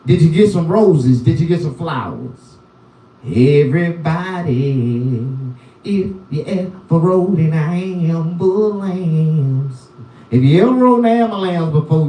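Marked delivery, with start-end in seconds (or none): 3.2-9.9 slow drawn-out call. Acted. Comic calling out his catchphrase to viewers, slow and drawn out. 10.3-12.1 quick throwaway pace; fast rattled-off. Acted. Comic repeating his catchphrase fast and offhand.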